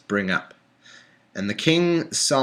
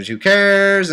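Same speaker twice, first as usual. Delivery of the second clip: loud, high "who cares"; exasperated dismissal; 9 semitones higher, 7 dB louder than usual. Real, not acted. Reader brushing off Bible details, loud scoff. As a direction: toss it off in disgust.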